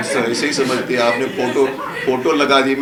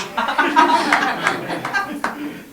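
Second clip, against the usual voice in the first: big laugh. Real, not acted. Laughs at his own teasing. (ज़ोर की ऊँची हँसी)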